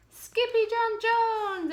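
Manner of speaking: high, slow, loud cheer; joyful cry. Acted. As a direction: call his name with joy. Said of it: "everyone cried".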